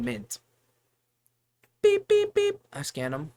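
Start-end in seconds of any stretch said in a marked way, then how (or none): 1.8-2.6 high, loud imitated scanner beeps. Cashier mimicking the register scanning cigarettes with high beeps. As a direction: chirp it out like a checkout scanner.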